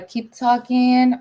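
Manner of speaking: usual speaking voice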